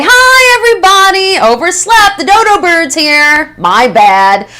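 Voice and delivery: high, loud, breezy sing-out; imitating late employee. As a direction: call it out like a chirpy latecomer. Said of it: "she'll give me my coffee and say".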